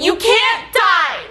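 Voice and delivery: high, loud, drawn-out mock wail; hammed-up tearful outburst; imitating bawling. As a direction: wail it slowly like a distraught sister. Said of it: "And she starts bawling".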